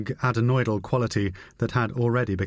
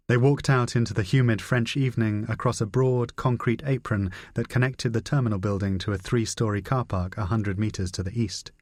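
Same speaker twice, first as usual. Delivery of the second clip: ordinary delivery, nothing marked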